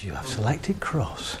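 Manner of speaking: a quiet, flat computer confirmation; robotic unconscious mind. Acted. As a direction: inner voice repeating the word back.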